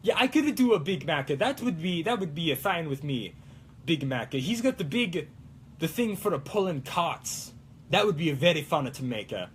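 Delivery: mock Italian accent, lively and sing-song; put-on Italian accent. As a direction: keep up a playful Italian accent. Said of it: "like the Italian one".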